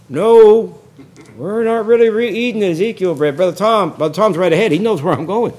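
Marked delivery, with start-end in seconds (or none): none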